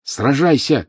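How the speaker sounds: high, loud shouted challenge. A narrator's reading. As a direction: shout it at him. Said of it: "крикнул Гарри".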